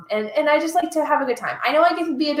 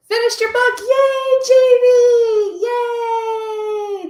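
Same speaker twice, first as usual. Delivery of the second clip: high, loud celebration; delighted high-pitched hooray; excited cheering; 12 semitones higher, 7 dB louder, much slower than usual. Real, not acted. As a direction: cheer them on with long, ringing yays. Cheering a viewer's finished book.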